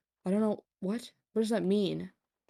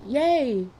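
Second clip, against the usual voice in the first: high, loud little cheer; mock cheer; 7 semitones higher, 6 dB louder than usual. Streamer hearing that Tomato loves them, hammed-up cheer. Mock delight at being loved.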